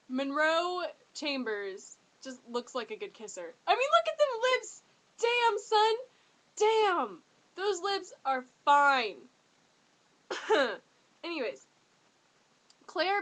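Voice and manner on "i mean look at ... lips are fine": high, loud fangirl squeal; excited gushing. Real, not acted. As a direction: swoon loudly over his lips. Gushing over actor's lips.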